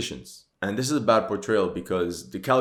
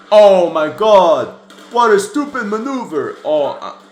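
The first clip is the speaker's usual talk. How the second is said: exasperated shout, much higher and louder; real anger; 10 semitones higher, 12 dB louder than usual. Real, not acted. Reacting to a war movie, furious shouting. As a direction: blow up at the stupid maneuver on screen. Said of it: "I'm getting mad".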